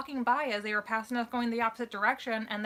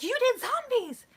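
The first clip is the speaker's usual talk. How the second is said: high and loud, thrilled to spot them; excited fan shout; 14 semitones higher, 5 dB louder, slower than usual. Retold or imitated. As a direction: blurt it out like a starstruck fan. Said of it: "really loudly and really with a lot of emotion".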